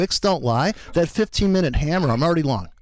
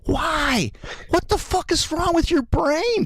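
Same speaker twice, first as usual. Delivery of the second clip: much higher, yelled disbelief; exasperated shout; 13 semitones higher, slower than usual. Real, not acted. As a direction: shout it at a trader who won't pull the trigger. Mocking hesitant trader, exasperated.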